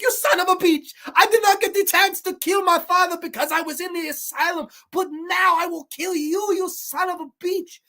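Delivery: high, over-the-top villain rant; hammed-up crazy killer. Crazy killer wife raging at her husband in the finale, hammed-up. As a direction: spew it out in a mock-deranged high voice. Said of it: "she's just spewing ... hamming it up".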